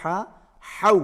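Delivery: "ہا، ہو" with a throaty Arabic h; from the throat. Shown as a demonstration. Teacher modelling for pupils, "ہا، ہو" articulated from the throat. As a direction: push the h of "ہا، ہو" out from the throat. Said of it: "حلق سے ہوگا ادا".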